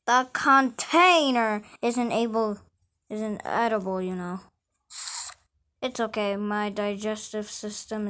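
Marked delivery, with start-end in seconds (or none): none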